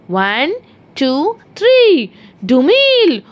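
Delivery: slow, stretched count rising to the bang; suspenseful count for a small child; playful countdown. Pretend. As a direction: draw out each number, then boom. Counts down to toy crash.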